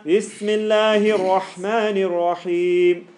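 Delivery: slow, loud, drawn-out Bismillahir Rahmanir Rahim; model recitation. Shown as a demonstration. Teacher models the recitation for the class, slow and loud. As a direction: recite it slowly and fully as a model. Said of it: "So, Bismillah".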